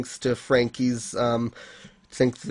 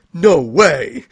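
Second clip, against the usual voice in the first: louder exclamation of disbelief; acted group reaction, louder; shocked disbelief; 2 semitones higher, 6 dB louder than usual. Recapping the crew's reaction to the unmasking, acted disbelief. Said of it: "everyone reacts like".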